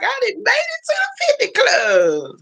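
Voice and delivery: very high, wailing mock cheer; mock celebratory cry. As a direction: squeal it out as her, hammed-up. Mimicking her future boast.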